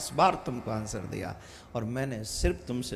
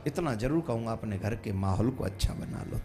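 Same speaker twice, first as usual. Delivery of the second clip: normal talking voice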